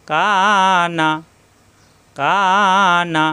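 long "কা", short "না"; one-alif madd. Shown as a demonstration. Teacher drills a madd word, drawn-out "কা" before "না". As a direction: hold the aa of "কা", then release on "না". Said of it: "এক আলিফ টান".

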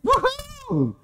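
a very high, joyful whoop; excited woohoo. Pretend. Preacher playing a family arriving at the destination, excited whoop. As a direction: whoop like the family finally pulling in.

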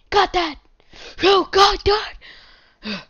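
loud, high, worked-up outburst; losing cool. Pretend. Gamer lectures viewers about coal, losing cool. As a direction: blow up at the listeners, pitch jumping. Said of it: "I lost my cool there".

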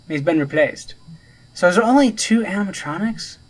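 ordinary talk

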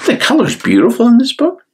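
high, fast admiring aside; delighted aside. Real, not acted. Reader telling a child how lovely the colours are, high and gushing. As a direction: gush about the pictures.

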